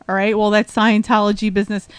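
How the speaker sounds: ordinary delivery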